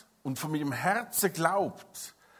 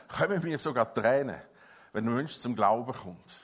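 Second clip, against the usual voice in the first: ordinary delivery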